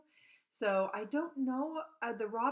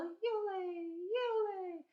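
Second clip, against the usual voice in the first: thrush call; 11 semitones higher, much slower than usual. Retold or imitated. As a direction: sing like a wood thrush.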